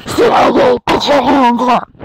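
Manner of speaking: loud commanding shout; soldier shouting. Acted. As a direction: bark it like a soldier. Soldiers storming cave.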